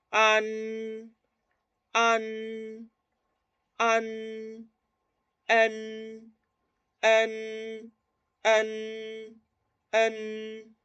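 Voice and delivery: tongue up, let "an" and "en" ring through nose and mouth; sustained alveolar N closing each "an" and "en"; front nasal N. Shown as a demonstration. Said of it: "es más nasal anterior".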